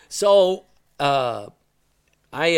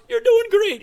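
much higher, louder mock-cheery encouragement; cheery instructor; 8 dB louder than usual. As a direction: chirp it like an upbeat coach. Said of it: "and he goes".

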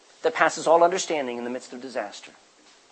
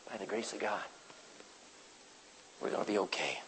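hushed; 9 semitones lower, 18 dB quieter than usual. Retold or imitated. Grieving parents answer pastor.

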